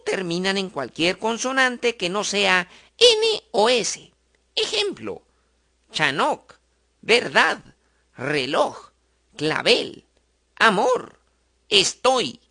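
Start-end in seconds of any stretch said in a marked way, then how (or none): none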